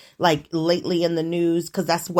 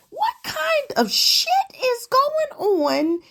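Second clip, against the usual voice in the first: real outrage at the news; shocked outrage; 10 semitones higher than usual. Real, not acted. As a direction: voice leaps up in stunned outrage.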